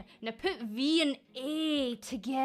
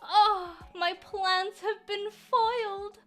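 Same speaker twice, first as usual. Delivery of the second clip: higher, slow melodramatic lament; mock foiled villain; 5 semitones higher, much slower than usual. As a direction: wail like a cartoon villain foiled. Sarcastic villain plans foiled.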